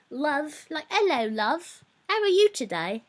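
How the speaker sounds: high, lilting Cockney greeting; British accent. Retold or imitated. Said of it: "still talking in a British accent".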